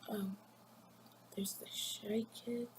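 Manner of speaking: slow, hushed whisper. Shown as a demonstration. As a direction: whisper it like the shy kid would. Introducing the shy kid type.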